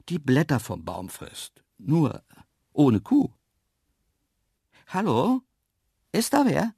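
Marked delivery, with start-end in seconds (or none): 4.9-6.7 higher, louder questioning call. A narrator's reading. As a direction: call into the half-dark to see who's there. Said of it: "rief ich ins Halbdunkel hinein". (fragend rufen)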